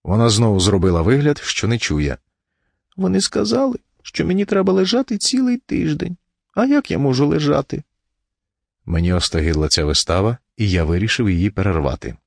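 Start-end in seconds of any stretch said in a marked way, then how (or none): 3.0-7.8 high, feeble invalid's complaint; self-pitying wife. A narrator's reading. Wife tells husband of doctors' orders, plaintively. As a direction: plead weakly in a raised pitch.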